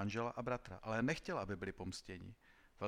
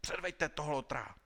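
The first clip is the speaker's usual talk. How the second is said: a high, loud roar of command; furious marshal bellowing for the prisoner; marshal's roar; 6 semitones higher, 4 dB louder than usual. A narrator's reading. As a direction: bellow the order across the table. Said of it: "a zařval".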